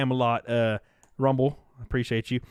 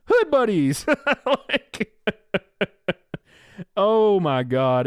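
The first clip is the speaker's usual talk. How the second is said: higher outburst breaking into laughter; real laughing; 3 semitones higher, slower than usual. Real, not acted. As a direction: crack up at your own joke. Cracks up at own joke idea.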